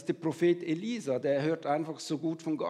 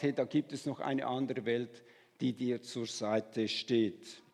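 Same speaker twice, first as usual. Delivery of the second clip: softer, slowing right down; gentle reassurance; 4 semitones lower, 5 dB quieter than usual. Acted. Elisa tells the frightened servant of another world, gently. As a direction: soothe a frightened listener. Elisa voiced comforting the reader.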